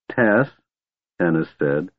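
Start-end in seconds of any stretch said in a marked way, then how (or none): 0.1-0.5 firm, raised-pitch warning. A narrator's reading. Half-elf has had enough of the kender, warning. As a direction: say the name as a final caution. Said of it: "in a voice that warned the Kender".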